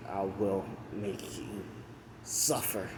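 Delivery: soft, slow acted threat; retold menace. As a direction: act out a hushed vow of revenge, drawing it out. Retold vow of enraged hero.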